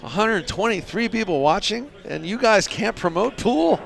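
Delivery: high, incredulous teasing complaint; mock outrage. Streamer shaming viewers for not promoting pool, mock outrage. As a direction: stretch it out in high-pitched disbelief.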